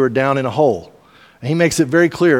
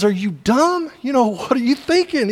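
incredulous; 10 semitones higher than usual. Retold or imitated. Stuck man scolds friend.

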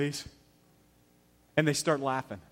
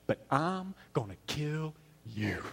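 slow, soft tough-guy threat; John Wayne; 7 dB quieter, slower than usual. Retold or imitated. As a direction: imitate a movie cowboy's quiet, drawn-out menace. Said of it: "There is this moment of toughness".

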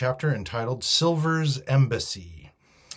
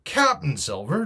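higher questioning echo, rising at the end; incredulous cry; 5 semitones higher, 5 dB louder than usual. A narrator's reading. Captain questions the mutineer's title for Silver, incredulous cry. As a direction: throw the name back as a question. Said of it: "cried the captain".

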